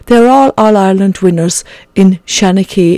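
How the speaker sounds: ordinary delivery, nothing marked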